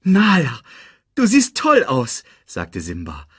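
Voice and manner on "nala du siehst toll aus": high, loud delighted greeting. A narrator's reading. Simba compliments Nala at their reunion, delighted. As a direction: gush over a long-lost friend.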